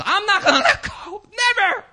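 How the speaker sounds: very high, strained mock screech; desperate defiant Joab. Joab refusing to let go of the altar, mock desperate squeal. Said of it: "And Joab says".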